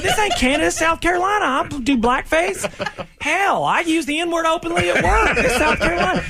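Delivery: a high, cartoonish Southern sheriff bragging; high hammed-up Southern. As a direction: ham up a Southern good-ole-boy, squeaky and proud. Parody redneck cop, laughing.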